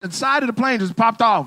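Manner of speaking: normal talking voice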